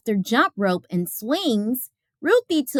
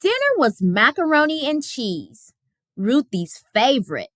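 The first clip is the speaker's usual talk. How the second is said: ordinary delivery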